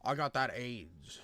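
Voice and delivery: low, quiet mock rap verse; imitating the rapper. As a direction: put on the rapper's subdued low delivery. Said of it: "I need you to be like".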